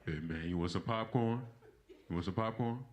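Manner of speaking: dad offering popcorn. Retold or imitated. Dad to his son after the beating, low gruff voice. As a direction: play the dad who just beat him. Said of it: "my dad crazy".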